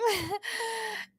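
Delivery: short burst of high laughter; high giggle. Real, not acted. Host retells an awkward phone call, high giggle. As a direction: giggle after your own line. Laughs after retold reply.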